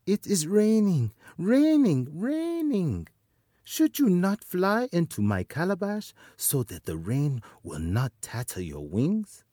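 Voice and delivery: trick the hornets sweetly; sly spider voice. Acted.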